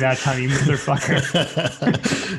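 slow, laughing mock insult. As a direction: drag it out while laughing. Mock insult after missed cue.

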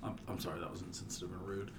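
quiet mock sorry; mock contrite apology. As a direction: mumble a fake-sorry apology. Mock apology after being corrected.

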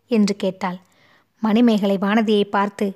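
usual speaking voice

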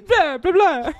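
loud, high Transylvanian blah-blah; mock Transylvanian. Teasing the friend about the last guess, mock Transylvanian. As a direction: tease with a loud mock Transylvanian cackle-word.

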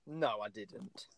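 deadpan. Real, not acted. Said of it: "So was I".

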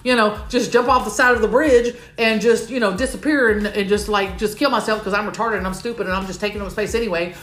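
fast spiraling rush of words; racing thoughts. Real, not acted. Confessing her anxious spiral to the audience, fast. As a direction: rattle it all off, spiraling. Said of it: "I'm already freaking out".

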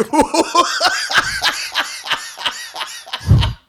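unvoiced high-pitched squeal laugh. Real, not acted. Laughing at the story.

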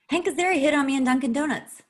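high, loud excited blurt after a gasp; excited reveal. Real, not acted. Remembering a celebrity encounter, excited high blurt. Gasped sudden revelation.

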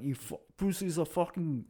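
ordinary talk

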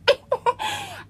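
high gasp breaking into a laugh; gasp, then laugh it out; shocked gasping laugh. Retold or imitated. Recalling the guys ignoring her: high shocked gasp-laugh. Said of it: "I gasped".

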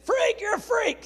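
high, loud, slow jeer; mocking bully shout. Girl in gym class taunting the Bible kid, loud jeer. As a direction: taunt him like the schoolyard bully.

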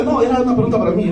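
normal talking voice

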